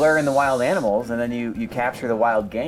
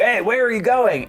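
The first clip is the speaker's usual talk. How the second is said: calling after; 8 semitones higher, 4 dB louder than usual. Retold or imitated. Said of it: "and then he's like".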